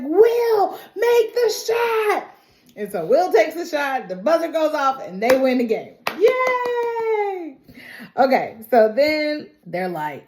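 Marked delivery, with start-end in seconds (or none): 0.0-2.3 high, slow urgent cry to a teammate; last-second plea to the shooter, high and drawn out; excited call. Retold or imitated. Jet passing in final seconds. 6.2-7.6 cheering yay. Real, not acted. Winning the game.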